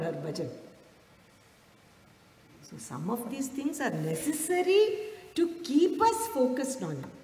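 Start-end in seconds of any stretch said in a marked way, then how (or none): none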